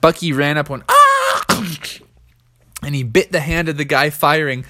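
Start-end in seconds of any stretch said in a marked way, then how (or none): 0.9-1.4 sharp high yelp; a quick high cry as he lunges; startled high yelp. Acted. Bucky attacks, cry.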